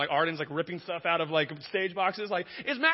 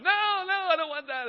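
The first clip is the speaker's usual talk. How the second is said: much higher, louder panicked no; a father panics at the thought of losing his child, much higher and louder; mock panic; 14 semitones higher, 9 dB louder than usual. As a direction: squeal out the panic. Panicked retold outburst.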